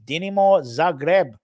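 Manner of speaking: Dinamo Zagreb with a mock Croatian accent; hammed-up Croatian accent on Dinamo Zagreb; put-on foreign accent. Shown as a demonstration. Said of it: "I don't know why I put the accent on".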